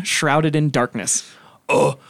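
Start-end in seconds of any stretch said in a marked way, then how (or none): 1.7-2.0 higher, nervous orc captain pleading; orc pirate captain. Acted. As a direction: grovel to the boss. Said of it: "Captain Coldfinger".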